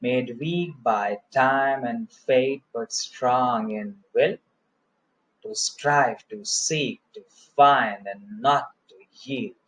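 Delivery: slow, measured stresses on "weak", "time", "fate", "strong"; rhythmic verse recital. Shown as a demonstration. Teacher reciting Tennyson's Ulysses for learners, slow rhythmic scansion. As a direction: set "to strive", "to seek", "to find" apart with pauses. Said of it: "I will try to make it rhythmic".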